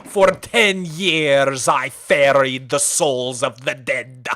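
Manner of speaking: slow, low cursed captain lament; Davy Jones impression. Davy Jones bit for chat, slow low lament. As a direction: drag it out like a doomed ferryman. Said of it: "I had a Davy Jones impression".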